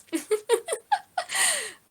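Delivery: light fond chuckle; a short amused laugh; character laugh. Acted. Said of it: "they're just gonna laugh".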